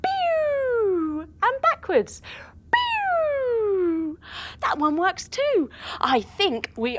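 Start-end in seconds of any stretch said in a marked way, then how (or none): 0.0-1.3 playful zap noise; quick cartoon laser pew; laser pew sound. Testing the steering controls. 2.7-4.2 playful zap noise; quick cartoon laser pew; laser pew sound. Testing the steering controls.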